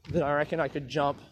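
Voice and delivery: ordinary talk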